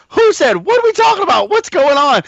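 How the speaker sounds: high, loud bewildered questions; panicked husband demanding answers; confused alarm. Retold or imitated. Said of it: "he was like".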